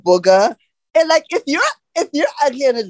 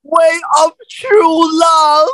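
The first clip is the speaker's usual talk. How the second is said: dramatic declaration; 9 semitones higher, 4 dB louder than usual. Pretend. Dramatic quote from the show.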